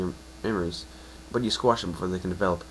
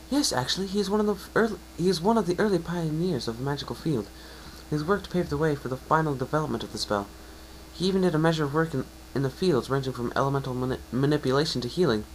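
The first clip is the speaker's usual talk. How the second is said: higher, eager scholarly mare voice; unicorn mare; 7 semitones higher than usual. Acted. Unicorn mare telling the pegasus about Star Swirl, eager and higher. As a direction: share the history with growing excitement.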